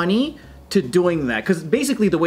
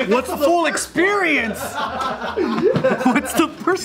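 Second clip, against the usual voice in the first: giggling through the words; laughing while asking; 11 semitones higher than usual. Retold or imitated. Laughing mock naive quote.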